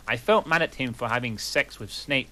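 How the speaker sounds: ordinary talk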